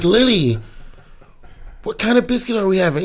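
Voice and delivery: sing-song a doting mom; mock sweet mom voice. Mom coaxing toddler in line.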